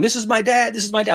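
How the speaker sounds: put-on high, eager kid voice; imitating excited boy. Retelling the boy showing off his dad, high kid voice. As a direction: pipe up like a proud little boy.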